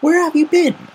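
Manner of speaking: a mother greeting her late daughter; Velvet's voice. A narrator's reading. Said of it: "Twilight Velvet smiled and asked".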